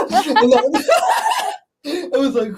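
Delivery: words pushed out through laughter, slow; real giggling while speaking; laughing. Real, not acted.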